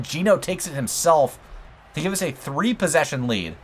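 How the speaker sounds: normal talking voice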